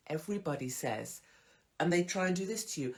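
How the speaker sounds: ordinary talk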